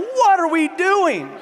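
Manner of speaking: high, drawn-out exasperated wail; mock panic. Stuck with two bawling passengers for seven hours, mock panic. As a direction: stretch it out high in comic alarm.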